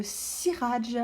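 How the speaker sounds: "Siraj" with a long hissed "Sss" at the start; "Siraj", slow, first consonant drawn out; exaggerated first sound. Shown as a demonstration. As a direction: hold the opening S of "Siraj". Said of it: "Vous entendez le s".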